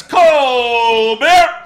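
high, loud vendor holler; beer vendor yell. Retold or imitated. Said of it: "the beer salesman guy".

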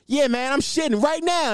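lightly voiced surfer dude; a hint of a bro voice.

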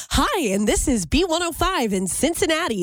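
perky radio DJ voice; bright upbeat station ID; chipper radio announcer. Shown as a demonstration. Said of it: "I thought I had to be more chipper".

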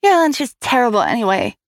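put-on a child sassing back at her stepmother; snippy comeback; shot back. A narrator's reading. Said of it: "Gudrun shot back".

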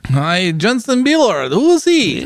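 high, slow, hammed-up Iranian accent; mock Iranian accent. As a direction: play along as the Iranian cousin in a jokey Iranian accent. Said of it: "I'm cousin from Iran".

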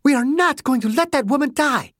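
high, furious woman's voice; a hint of rage. A narrator's reading. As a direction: hold back nothing, she is enraged. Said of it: "A rage exploded inside her".

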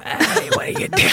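high raspy laughing smoker; casino smoker voice. Retold or imitated. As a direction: croak it like a chain-smoker. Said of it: "someone that hangs out in a casino all day".

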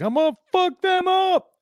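high, loud cocky dog voice; dog's inner voice. Retold or imitated. Voices his dog deciding to attack the geese, high and loud. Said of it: "looked over his shoulder at me like".

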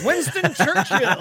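high, mocking imitation through laughter; silly high put-on voice; retold Jera. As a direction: mimic a bad Churchill attempt, high-pitched. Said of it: "He's like".

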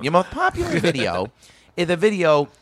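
high-pitched talk-show host voice; Jay Leno impression. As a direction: imitate a late-night host stumbling into his question. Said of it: "Leno's gonna be like".

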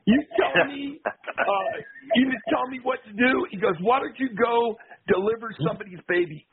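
lightly hammed-up Piper taunt; Roddy Piper voice. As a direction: taunt the doctor, wrestler style.

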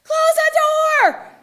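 high, drawn-out full yell; piercing alarm from inside the head; yelling inner voice. Retold or imitated. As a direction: bellow a slow, urgent command as loud as you can. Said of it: "yells about as loud as it can".

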